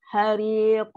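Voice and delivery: Hariq ending in a bouncing q; qalqalah on the q. Shown as a demonstration. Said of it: "Kat sini ada qalqalah".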